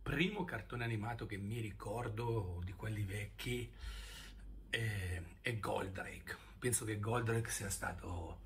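normal talking voice

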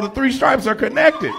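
ordinary talk

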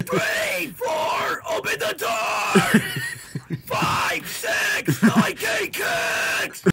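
high, screamed counting rhyme; shouted nursery rhyme. Pretend.

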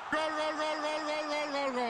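rapid-fire goal shout, pitched high; breakneck goal commentary. Real, not acted. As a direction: rattle off gol gol gol. Goal call, breakneck repeated goals.

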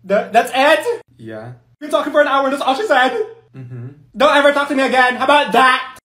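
high, loud indignant rant; outraged yelling. Acted. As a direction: yell at her in disbelief.

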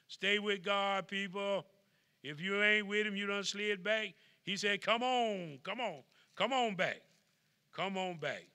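loud, slow, rising preacher's call; preaching at full force. Acted. Preacher pleading with his slipping congregation, loud and rising. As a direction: call the people back to God.

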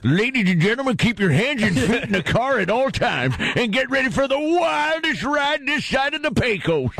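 high, hammed-up carnival barker; Wild West ride announcer. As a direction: announce the wildest ride in town. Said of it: "working in a ghost town out west".

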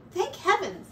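high, light mouse voice; Mouse, relieved. A narrator's reading. Mouse, safe at home, high and relieved. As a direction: pitch it up like a tiny mouse. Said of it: "said Mouse".

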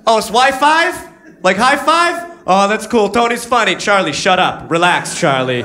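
high, chuckling dopey voice; Tony laughing at his own pun, pitched high; goofy Tony. Retold or imitated. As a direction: play the easily amused coworker. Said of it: "He's like".

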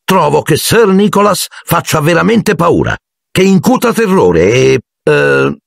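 high, earnest praise that falters; coached compliment in a high voice; blurting boy. A narrator's reading. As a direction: pipe up to back your friend, then stall. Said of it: "snocciolò Harry tutto d'un fiato".

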